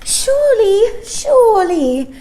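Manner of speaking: put-on bright, very high little girl pleading; a small star-child begging to be remembered. Child pleading with Mary Poppins, high little girl voice.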